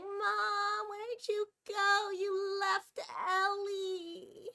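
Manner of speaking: high, slow, whiny cat voice; a cat wailing for her owner, high and drawn out; voicing the crying cat. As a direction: whimper it like an upset kitty calling for mom. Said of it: "started yelling her little head off".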